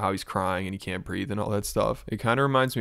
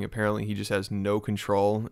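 ordinary talk, nothing marked

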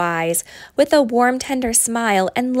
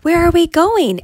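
much higher, louder, panicky; the donkey asks again, more scared; more frightened repeat; 7 semitones higher, 6 dB louder than usual. A narrator's reading. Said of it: "And then said again".

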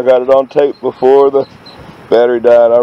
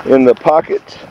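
ordinary delivery

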